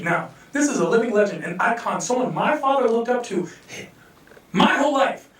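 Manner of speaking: normal talking voice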